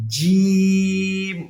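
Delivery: "জিম" held long on one level note, tongue middle to palate; drawn-out jim. Shown as a demonstration. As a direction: stretch the vowel of "জিম", then close on the m. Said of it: "তার বরাবর উপরের তালুর সঙ্গে লাগবে". ("জিম" জিহ্বার মাঝখান তালুতে লাগিয়ে লম্বা টেনে)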